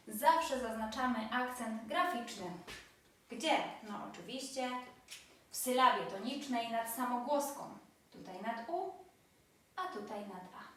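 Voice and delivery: ordinary talk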